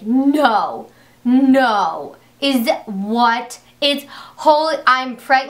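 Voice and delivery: panicked freak-out. Retold or imitated. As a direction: blurt it out in shock, half-crying. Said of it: "I'm just freaking out".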